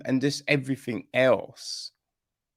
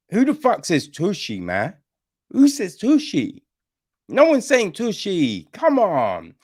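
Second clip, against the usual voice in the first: higher and louder, outraged disbelief; incredulous rant; 8 semitones higher, 6 dB louder than usual. Real, not acted. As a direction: fire off the question like it's absurd. Ranting about the word.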